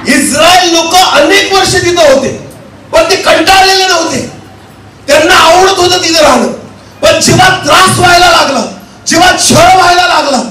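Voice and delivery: high-pitched, declaiming sermon voice; impassioned preaching. Acted. Preacher telling congregation of Israel's suffering, impassioned preaching. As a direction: drive each phrase out to the congregation.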